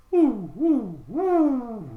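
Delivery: high, loud hooting owl. Shown as a demonstration. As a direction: hoot like an owl. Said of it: "Mister Owl goes".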